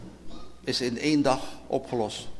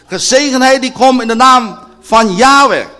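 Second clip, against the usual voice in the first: high, ringing, full-voiced blessing; loud proclamation; 9 semitones higher, 14 dB louder than usual. Preacher proclaims the blessing to the congregation, ringing loud. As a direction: proclaim it as the climax.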